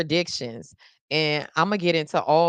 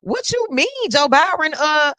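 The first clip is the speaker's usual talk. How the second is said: retold stunned question, pitched way up and louder; shocked disbelief; 11 semitones higher, 5 dB louder than usual. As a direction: squeal it out like you can't believe the news. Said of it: "I was like".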